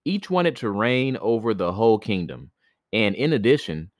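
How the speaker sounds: ordinary delivery, nothing marked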